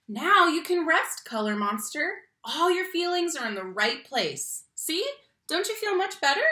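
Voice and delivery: lively, expressive picture-book reading; reading to children. Acted. Teacher reading a picture book to her class, expressive storytime voice. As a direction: read it like storytime for little kids.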